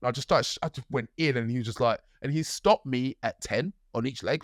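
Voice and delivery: usual speaking voice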